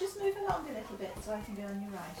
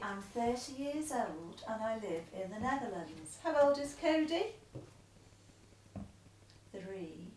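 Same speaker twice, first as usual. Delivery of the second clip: letter read aloud; 7 dB louder than usual. Acted. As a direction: read the letter out steadily to him.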